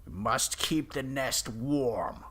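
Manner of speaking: high, loud, slow creature voice. Acted. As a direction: drag each word out like a beast.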